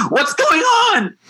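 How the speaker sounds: high, slow, frightened question; mock-frightened high cry of confusion; startled alarm. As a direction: play the scared sister jolted awake. Sister freaking out at night.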